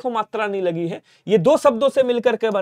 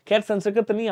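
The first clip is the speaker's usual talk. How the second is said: normal talking voice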